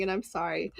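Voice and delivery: usual speaking voice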